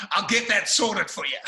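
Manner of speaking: laughing Aussie reply; broad Australian accent. Retold or imitated. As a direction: imitate the Australian waiter, breaking into laughter. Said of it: "he spoke with a very broad Australian accent".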